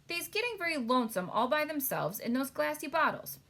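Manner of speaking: a hint of a gentle giant, eager; big friendly giant voice. BFG's speech in read-aloud story.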